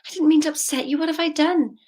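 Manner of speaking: a panicked auntie; flustered auntie. Retold or imitated. Auntie apologising to crying child, high and flustered. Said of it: "She goes".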